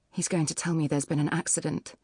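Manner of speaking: ordinary delivery, nothing marked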